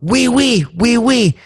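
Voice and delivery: high-pitched French tourist agreeing; eager French guy. Acted. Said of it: "the French guy goes".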